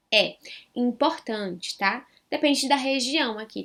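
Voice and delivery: ordinary talk, nothing marked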